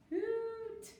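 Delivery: high-pitched drawn hoot; owl hoot. A narrator's reading. Owl too frightened to speak, high hoot. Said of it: "hooted Owl".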